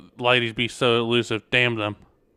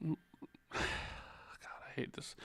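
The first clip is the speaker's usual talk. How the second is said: ordinary delivery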